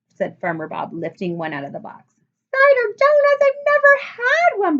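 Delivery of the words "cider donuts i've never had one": very high, loud excited squeal; a child amazed by a new treat; thrilled squeal. A narrator's reading. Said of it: "Jessie said".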